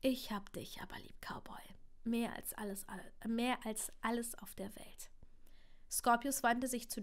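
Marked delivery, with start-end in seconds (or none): 0.0-5.1 soft fatherly whisper at bedtime; tender whisper. Acted. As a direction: whisper it to a sleepy child. Father soothes child at bedtime.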